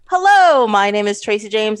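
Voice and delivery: ordinary talk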